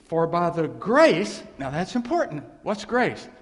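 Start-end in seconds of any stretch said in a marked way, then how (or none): 0.1-1.4 scripture reading. Acted. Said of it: "reading Romans aloud".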